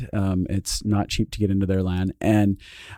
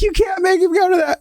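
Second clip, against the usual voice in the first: high mock protest, laughing; imitating the wife. Wife objecting to husband's plan, high mock voice. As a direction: whine it like the worried wife.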